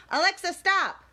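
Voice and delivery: usual speaking voice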